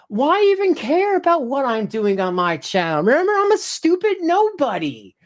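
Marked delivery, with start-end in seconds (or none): 3.0-5.2 high, sing-song sarcastic put-down; mock the haters' view of yourself; sarcastic self-mockery. Sarcastically echoing his haters, high and sing-song.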